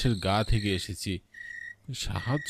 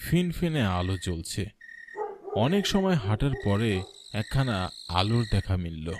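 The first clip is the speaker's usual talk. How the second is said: ordinary talk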